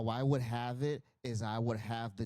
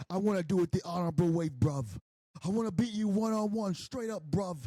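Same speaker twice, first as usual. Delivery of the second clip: imitating a London lad, higher and louder; mock British accent; 7 semitones higher, 3 dB louder than usual. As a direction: put on a sing-song London voice. Voicing Will Ospreay's plea.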